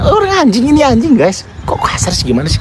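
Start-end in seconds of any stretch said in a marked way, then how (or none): 0.0-1.5 much higher put-on quoting voice; mock quote. Joking about rude words to viewers, silly high quote. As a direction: parrot the line in a silly high voice. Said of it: "Jangan ngomong kasar".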